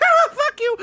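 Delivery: hammed-up childish outburst; mock Buu yell. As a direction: shriek it like a bratty monster. Buu voiced in a retelling.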